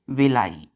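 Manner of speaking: विलाई said as vi-lai, ending in lai; pronouncing villi. Shown as a demonstration. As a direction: read विलाई aloud as vi-lai for the class. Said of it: "इसको क्या पढ़ते हैं?".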